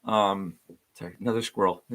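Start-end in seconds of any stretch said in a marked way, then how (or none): none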